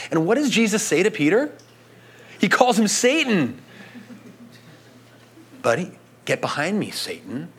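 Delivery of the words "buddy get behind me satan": low, hushed, fast rebuke; quiet rebuke. Retold or imitated. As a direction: drop to a soft, firm rebuke. Jesus rebuking Peter.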